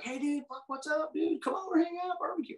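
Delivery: high, breezy mock dude voice; imitating Vince. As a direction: lay on the new best-buddy act, way up. Vince now friendly, acted quote.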